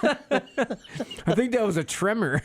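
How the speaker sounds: slow, high laughing remark; stifle a laugh; laughing mock-serious. Acting churchgoer, laughing.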